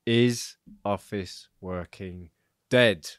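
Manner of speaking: very slow, with a dramatic pause before "dead"; spaced-out big question, "dead" punched at the end; pause for effect. Shown as a demonstration. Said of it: "pause for effect".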